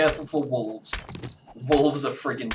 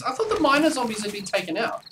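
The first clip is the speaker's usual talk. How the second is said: high, disbelieving surprise; surprised; 11 semitones higher, 4 dB louder, faster than usual. Real, not acted. Surprised by miner zombies.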